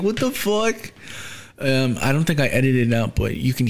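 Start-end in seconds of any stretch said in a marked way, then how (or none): 0.0-0.9 high-pitched startled what the fuck; a mock-startled blurt, pitched way up; shocked reaction. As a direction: caught off guard by grandpa walking in. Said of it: "And I'm like".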